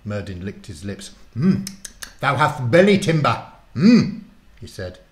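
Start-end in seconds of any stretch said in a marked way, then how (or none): 1.3-4.2 put-on loud, higher greedy old warlock; hungry warlock. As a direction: savour the thought of a meal. Warlock eyes the guinea pig hungrily.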